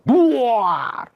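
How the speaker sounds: sudden high-pitched boom; explosive mock slam; imitated bang. Imitating a locker being kicked, sudden high boom. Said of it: "Itu loker kayak ada nendang".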